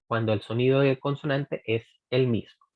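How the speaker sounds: ordinary talk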